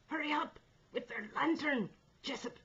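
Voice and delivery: high, loud, urgent call; breathless shout. A narrator's reading. Stubbins calling down to Jessup, breathless shout. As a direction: shout while catching your breath. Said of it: "he shouted, catching his breath between the words".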